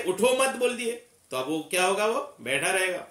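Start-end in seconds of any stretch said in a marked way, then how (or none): 0.0-0.5 उठो मत run together, higher; no pause after उठो. Shown as a demonstration. Teacher showing a missed stop, उठो मत in one go. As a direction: join "मत" straight onto "उठो". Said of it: "अगर आप नहीं ठहरे".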